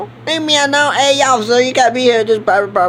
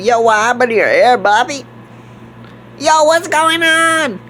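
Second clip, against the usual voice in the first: usual speaking voice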